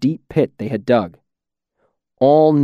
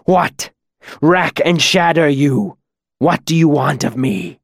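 raised, hissing stone-on-stone rasp; rasping demon; 3 semitones higher than usual. A narrator's reading. Bound demon demanding what his captor wants, rasping. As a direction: spit it out in a harsh demon rasp. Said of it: "his voice like the rasp of stone on stone".

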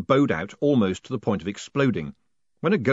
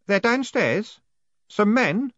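put-on nervous boy voice; 13 semitones higher, 4 dB louder, slower than usual. A narrator's reading.

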